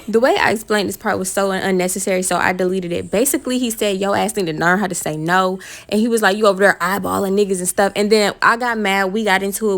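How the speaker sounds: loud, worked-up retelling; heated. Real, not acted. Retelling a fight with her boyfriend, loudly heated. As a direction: push it out loud and riled.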